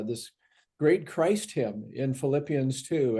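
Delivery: ordinary talk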